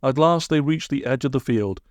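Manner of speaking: ordinary delivery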